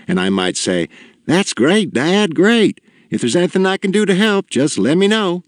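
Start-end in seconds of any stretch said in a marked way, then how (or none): 1.3-5.4 high, overeager cheerful voice; eager son. Pretend. Son cheering on his dying father, high mock-eager voice. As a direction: chirp it up, too bright to be sincere. Son's imagined eager reply.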